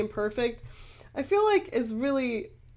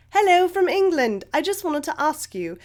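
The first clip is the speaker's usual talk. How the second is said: amateur put-on English accent; best English accent; 4 semitones higher, 4 dB louder than usual. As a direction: read it out in a playful attempt at English. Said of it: "in my best English accent".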